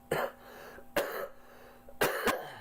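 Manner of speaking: coughing fit. Real, not acted. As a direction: cough hard, then carry on. Narrator's own coughing fit.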